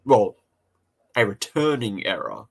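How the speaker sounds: ordinary delivery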